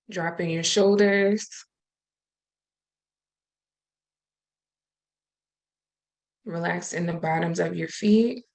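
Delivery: calm, unhurried breathing guidance with long pauses; guided meditation. Acted. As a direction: gently talk the group into stillness and breath.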